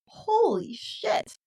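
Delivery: high, drawn-out stunned exclamation; shocked reaction. Retold or imitated. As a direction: stretch it out in disbelief. Voicing own shocked reaction to the film.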